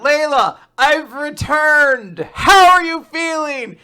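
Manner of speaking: loud, high, drawn-out call; shouting out. Acted. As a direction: holler grandly up to a hospital window. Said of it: "Rip from outside the window shouts out".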